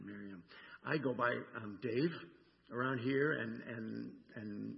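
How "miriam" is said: 1 tender name. Acted. Jesus tenderly calls her name.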